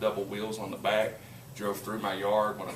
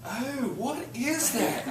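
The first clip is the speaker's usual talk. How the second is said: puzzled wife; 13 semitones higher, much slower than usual. Retold or imitated. Voicing wife touching his head.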